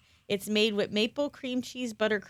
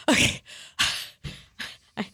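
usual speaking voice

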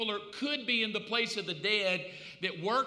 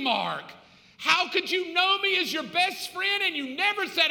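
loud, higher, anguished reproach; accusing shout from hell; 6 semitones higher, 4 dB louder than usual. Acted. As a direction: cry out at the preacher who never warned you. Souls in hell accusing the preacher.

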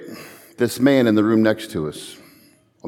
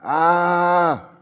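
higher pained cry of a suffering man; mock agonized groan; imitated cry of pain; 5 semitones higher than usual. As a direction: let out a strangled hospital cry. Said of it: "I've never heard a man cry out like this".

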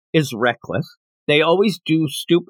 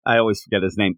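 ordinary talk, nothing marked

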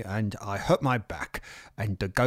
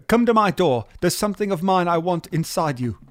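calling out; 6 semitones higher, 4 dB louder than usual. Acted. Calling to boy below.

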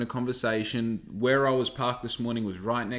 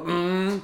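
high imitated outboard engine "mmm"; boat motor hum; 7 semitones higher than usual.

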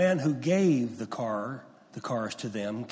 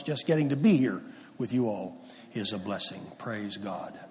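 ordinary talk, nothing marked

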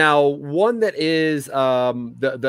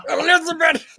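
put-on high, cracked old man cry; Fred Sanford impression. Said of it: "like a Fred Sanford".

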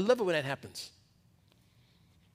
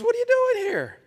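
high, louder angel voice calling out; angel's voice; 4 dB louder than usual. Acted. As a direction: boom it down like an angel questioning a runaway prophet. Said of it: "And the angel of the Lord comes".

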